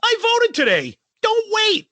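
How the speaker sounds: very high mock-proclamation. Host reading a singer's voting tweet, mocking impression. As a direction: announce it in a high, sing-out send-up. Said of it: "Then the next tweet is".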